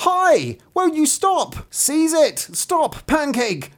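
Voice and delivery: very high, frantic storybook shouting; high-pitched crowd-in-pursuit yell; screamed out. A narrator's reading. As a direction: shriek after the runaway in short bursts. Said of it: "they all screamed out".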